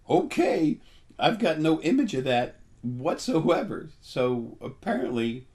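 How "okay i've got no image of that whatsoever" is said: high, loud, slowed amazement; startled disbelief. Retold or imitated. Shown a strange vision by spirit guides, startled and loud. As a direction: gasp it out like you've never seen such a thing. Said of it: "it's like".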